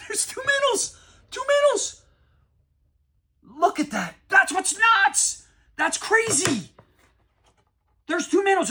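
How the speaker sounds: screaming high and loud with laughter; can't believe the luck, shouting it out; real ecstatic yells over two Mantles. Real, not acted. Finds two Mantle cards.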